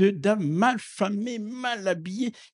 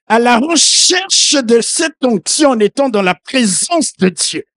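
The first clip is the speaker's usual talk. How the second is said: loud, impassioned sermon delivery; fervent preacher calling out; preaching at full force; 6 semitones higher, 9 dB louder than usual. Acted. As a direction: preach it out to the whole church.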